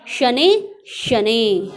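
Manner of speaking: शनै, शनै very slow and loud; deliberate reading-drill delivery of शनै, शनै; drawn-out word ending. Shown as a demonstration. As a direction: stretch the final vowel of each शनै. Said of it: "ह की sound आ रही है".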